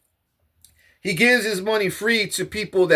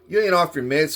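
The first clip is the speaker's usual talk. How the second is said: normal talking voice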